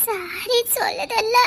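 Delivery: soft, slow, drawn-out plea; plaintive apology. Acted. Girl apologising again to her boyfriend, plaintive. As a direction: stretch it out in a small, wounded plea.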